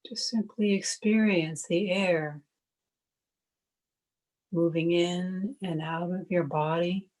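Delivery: calm, even meditation voice with long pauses; guided meditation. Acted. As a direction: guide listeners gently into stillness, one unhurried phrase at a time.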